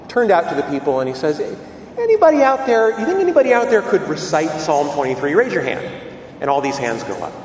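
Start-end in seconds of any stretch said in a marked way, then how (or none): 2.0-5.8 loud, high call to the audience; a priest calling out to the crowd, loud and high. Retold or imitated.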